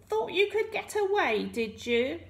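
high, taunting villain; scoffing. A narrator's reading. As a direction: scoff at the runaway, pitched right up. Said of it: "Rumpelstiltskin scoffed".

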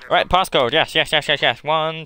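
loud rapid-fire yeses; loud urgency. Real, not acted. Player hurrying to get the passcode, loud urgency. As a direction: say it loud and impatient.

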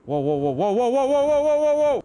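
building from plain to high loud panicked whoas; rising alarm as the enemy charges; panicked yelling. Real, not acted.

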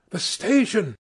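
high excited cry at the sight; cried out. A narrator's reading. Helmsman to captain, spotting the station, excited cry. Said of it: "he cried".

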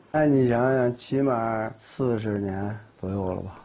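ordinary delivery

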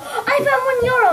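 high, loud excited call; shouted out. Retold or imitated. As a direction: shout it out, thrilled. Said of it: "I shouted out".

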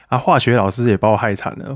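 ordinary delivery

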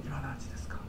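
very soft, barely projected question; hushed and small; tiny quiet voice. Shown as a demonstration. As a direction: murmur the question under your breath. Demo of tiny speaking voice.

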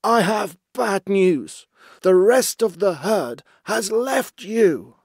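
high, loud, slow confession; terrified but determined. A narrator's reading. Basil telling his chief the herd has left, frightened resolve. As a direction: force out each word in a high anxious voice. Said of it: "Terrified but determined".

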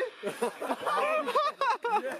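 long, high-pitched cackle of laughter; high laughing fit. Real, not acted. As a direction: burst out laughing, high and drawn out. Laughing after a joke.

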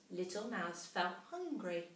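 ordinary delivery

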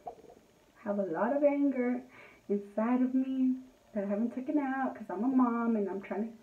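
usual speaking voice

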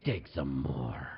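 very low, drawn-out order; mock menacing cop. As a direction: stretch it out low as the tough cop.

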